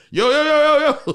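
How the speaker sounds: high, loud yo yo yo to nobody; loud calling out. Retold or imitated. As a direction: call out to imaginary friends across the street. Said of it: "I'm going like this".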